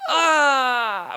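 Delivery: high pained Ah as if hit in the stomach; mock winded cry; gut-punch groan. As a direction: wince aloud from a blow to the gut. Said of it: "where you're like".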